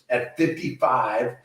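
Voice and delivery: usual speaking voice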